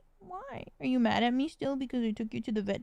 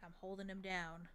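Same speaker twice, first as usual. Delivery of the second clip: soft near-whisper; hushed play with the cat; 11 dB quieter than usual. Real, not acted. Cat owner softly playing with her cat, hushed.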